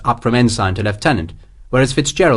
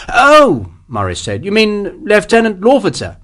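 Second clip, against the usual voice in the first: higher, louder oh of recognition; light up as it dawns; captain realizing who the general means; sudden realization; 9 semitones higher, 3 dB louder than usual. A narrator's reading.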